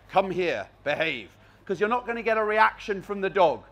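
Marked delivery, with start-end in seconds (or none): 0.1-1.3 low, soft stern order; stern command. Shown as a demonstration. Trainer shows the wrong, stern way to call a dog, low and flat. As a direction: bark it down at the dog without feeling. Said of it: "You're not up there going".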